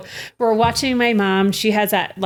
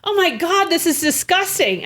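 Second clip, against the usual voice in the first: mom disgusted; 9 semitones higher than usual. Retold or imitated. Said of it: "she goes".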